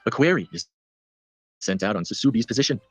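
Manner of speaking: steady storyteller read over music; story narration. Acted. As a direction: read the sci-fi piece like a short radio drama. Reads microfiction over music.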